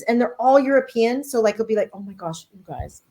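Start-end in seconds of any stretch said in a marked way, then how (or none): 1.9-3.0 very soft, low aside; hushed mock awe. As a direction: murmur it as an awed viewer. Acted reaction to European drivers.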